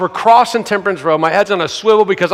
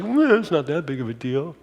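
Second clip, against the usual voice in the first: low-key, unbothered shrug of a voice; soft, laid-back brush-off; way too chill; 4 semitones lower, 8 dB quieter than usual. Acted. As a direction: play it mock-casual and quiet. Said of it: "is like, way too chill".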